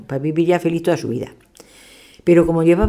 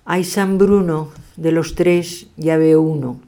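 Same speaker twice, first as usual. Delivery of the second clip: slow, louder sing-song rhyme; a slow, louder mock lament over the first plate; 3 semitones higher, 4 dB louder, much slower than usual. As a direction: drag out the rhyme like a sighing chant. Diviner's rhyming lament over dinner.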